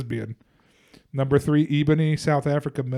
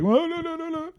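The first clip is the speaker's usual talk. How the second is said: mock protest; 13 semitones higher than usual. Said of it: "so everybody's like".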